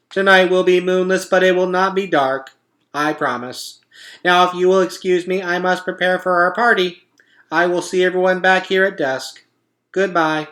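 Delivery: upbeat announcing machine; cheerful robot voice. A narrator's reading.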